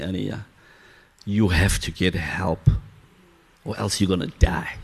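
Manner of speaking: ordinary talk, nothing marked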